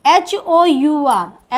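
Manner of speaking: एच ओ यू आर, each letter spaced and very slow; spelled out slowly. Shown as a demonstration. Teacher spelling hour letter by letter for the class. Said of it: "एच ओ यू आर को हम पढ़ते हैं".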